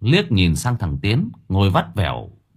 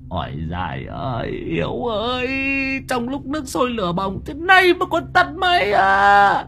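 much higher, crying out in terror; panicked wail. Acted. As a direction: wail for Hiếu like he's about to die. Panicked old man calling Hiếu.

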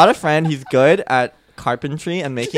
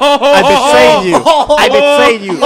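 hammed-up betrayal cry; 13 semitones higher, 11 dB louder than usual. Partygoer turning on his rapper friend, high dramatic shout.

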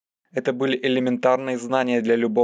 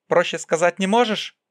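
higher, mildly exasperated question; impatient interruption; 6 semitones higher than usual. A narrator's reading. Priscilla asks Zod to speak simply, higher and impatient. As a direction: demand it simpler, pitch lifted. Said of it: "прервала его Присцилла".

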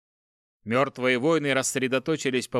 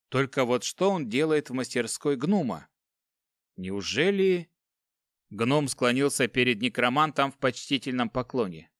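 plain reading voice

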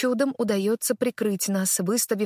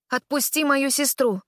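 higher, firm command; 6 semitones higher than usual. Acted. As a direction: order her to let go. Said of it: "приказываю я".

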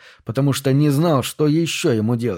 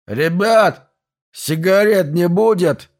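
put-on drunken neighbour, raised and drawled; town drunk; 5 semitones higher, 4 dB louder, slower than usual. A narrator's reading. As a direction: slur it like a drunk asking for smokes. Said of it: "Раздался пьяный голос соседа". (пьяный голос соседа)

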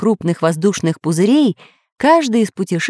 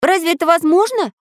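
higher disbelieving voice; is that even possible; robot doubting the plan; doubting surprise; 9 semitones higher than usual. A narrator's reading. Samodelkin doubting.

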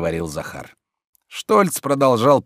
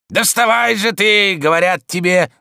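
roaring; 10 semitones higher, 6 dB louder than usual. A narrator's reading. Servant losing patience with sleeping master, roaring. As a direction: bellow at the sleeper to rise. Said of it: "заревел было Захар".

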